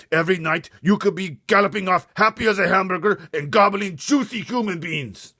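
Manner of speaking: high, very loud contemptuous rant; ranting giant. Acted. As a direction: scold him like a disgusted brute. Bloodbottler rants.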